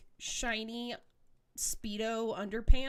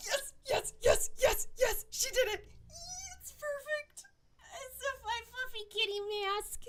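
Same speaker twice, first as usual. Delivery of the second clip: high, gasping squeals of joy; squealing with delight; 14 semitones higher than usual. Real, not acted. Gamer thrilled at kitty mask, high squeals. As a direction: squeal yes over and over.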